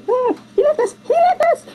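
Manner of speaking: very high squealed cry; high-pitched pretend panic; mock panicked kid. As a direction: squeal it like an abandoned child, hammed up. Voices abandoned kids in film retelling.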